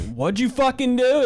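shocked yell. Retold or imitated. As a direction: demand it in shock. Imagined reaction to a dead body.

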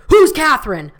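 high, loud mocking question; imitating a rude border guard, high and loud; dumb guard. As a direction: play the guard as loud and dense. Guard mocking her hometown.

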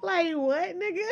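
high disbelieving squeal through laughter; what-are-you-on cackle of disbelief; incredulous laugh. Real, not acted. Reacting to absurd text.

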